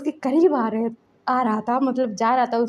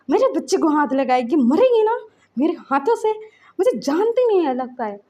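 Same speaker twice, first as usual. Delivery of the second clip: angry; 3 semitones higher than usual. Retold or imitated. Enraged father threatening the woman.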